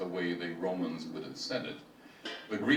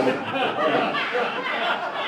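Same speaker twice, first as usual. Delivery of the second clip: high squealing laugh; 7 dB louder than usual. Real, not acted. Laughs at his own joke.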